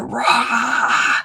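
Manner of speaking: long, drawn-out roar rising in pitch; mock roar. Shown as a demonstration. Healer showing it's okay to scream, demonstrated roar. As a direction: let out a drawn-out "rah" as a roar.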